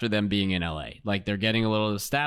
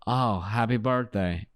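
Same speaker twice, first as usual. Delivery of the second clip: slow, mock foreign accent; Tommy's accent; 3 semitones lower than usual. Man who will not give in about his age, mock accent. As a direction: imitate an odd, unplaceable accent, drawn out. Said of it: "He has this clear accent".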